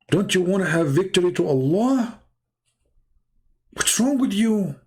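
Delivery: high-pitched, exasperated challenge; incredulous challenge. Real, not acted. Taunting absent opponents to debate him.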